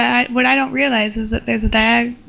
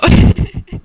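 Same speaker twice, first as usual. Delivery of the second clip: burst of laughter; 6 dB louder than usual. Real, not acted. Bursts out laughing mid-reading.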